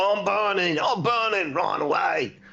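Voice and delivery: high, loud mock panic, far above normal; panicked Sporks. Game master voices burning Sporks running off, mock-panicked. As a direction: cry out like you're on fire and fleeing. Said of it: "He's like".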